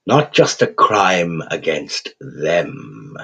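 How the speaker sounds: put-on slow, crooning English accent, gleefully cheerful; a cheerful English creature savouring every word. Raven creature chiding the thug in a cheerful English accent. Quoth's held voice.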